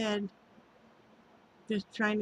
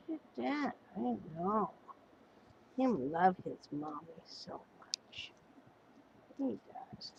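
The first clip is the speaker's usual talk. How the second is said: whispering; 11 dB quieter than usual. Real, not acted. Whispered baby talk to dog.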